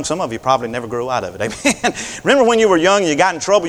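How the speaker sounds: normal talking voice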